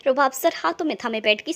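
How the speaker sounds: usual speaking voice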